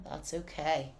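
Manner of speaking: ordinary talk, nothing marked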